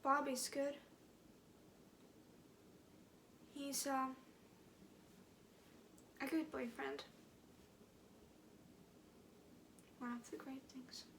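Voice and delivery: soft, halting words to a dying man; trailing off at the bedside; quiet grief. Acted. Performs audition monologue, quiet opening.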